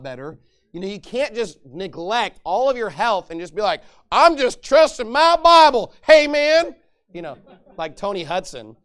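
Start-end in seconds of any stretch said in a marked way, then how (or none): 4.1-6.7 higher, louder, slow goofy voice; mock carefree believer. Pastor mocking a lazy believer, higher and louder. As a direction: play a careless dude.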